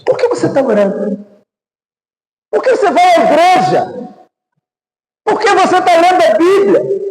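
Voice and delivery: high-pitched, hectoring mock questioning; acted challenge. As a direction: act out a discourager, building to high intensity. Acted discourager challenging a believer.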